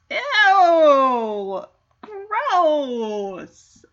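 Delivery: loud disgusted cry; grossed out. Real, not acted. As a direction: recoil in disgust. Reacting to the dog's joke.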